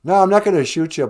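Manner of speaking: ordinary talk, nothing marked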